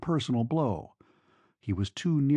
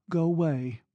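higher-pitched, cool dismissal; cold; 4 semitones higher than usual. A narrator's reading. Girl sending her lover off, coldly. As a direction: dismiss him coolly, pitched high. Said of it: "she said coldly".

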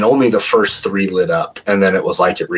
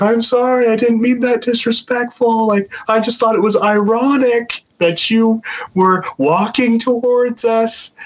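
high, pleading apologetic voice; nervous apology. Retold or imitated. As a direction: apologise to the spirit, high and anxious. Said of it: "Randy's like".

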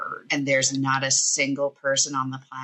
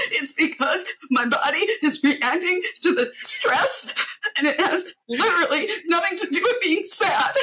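much higher, laughing through the words; high mock explanation; 13 semitones higher than usual. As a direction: squeak it out half-laughing. Mock-explaining crying to partner.

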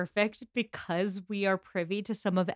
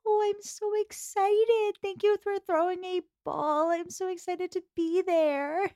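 much higher, slow, sugary gushing; gushing Jane; 10 semitones higher than usual. Retold or imitated. Imitating Jane thanking them for the ball, high and gushing. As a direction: coo it like an overeager girl at a party.

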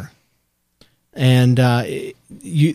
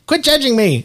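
mock pigeon voice; 11 semitones higher than usual. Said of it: "and he comes down".

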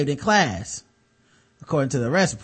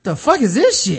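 outraged; 5 semitones higher, 5 dB louder than usual. Real, not acted. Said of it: "outraged comment".

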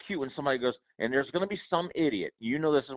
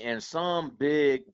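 ordinary talk

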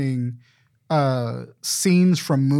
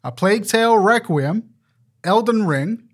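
announcer voice; 6 semitones higher, 4 dB louder than usual. Pretend. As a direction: read the list like an awards host. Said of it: "Read it like an announcer".

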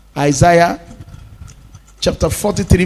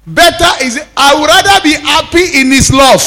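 higher, louder preaching burst; fervent preaching; 8 semitones higher, 4 dB louder than usual. Acted. Pastor urging church to choose love, fervent. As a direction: lift the voice in fervour.

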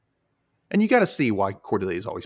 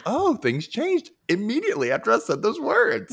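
mock ditzy realization; 13 semitones higher, 3 dB louder than usual. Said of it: "acting Cordelia's realization".

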